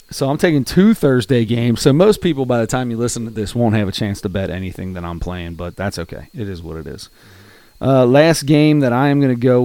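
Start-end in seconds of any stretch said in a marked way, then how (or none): none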